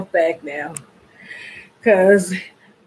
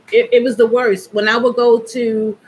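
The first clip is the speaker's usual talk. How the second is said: normal talking voice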